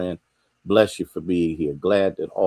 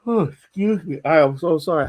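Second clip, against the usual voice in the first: high words caught in a yawn; apologising through a yawn; 11 semitones higher, 6 dB louder than usual. Real, not acted. Host yawns while greeting a viewer, yawning apology. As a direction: yawn and apologise, pitch lifted. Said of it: "I'm just yawning".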